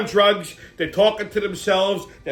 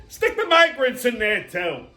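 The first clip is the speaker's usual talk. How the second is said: ordinary talk, nothing marked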